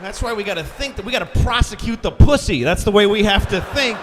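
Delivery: ranting at the crowd, building up; loud comic rant; rising rant. Acted.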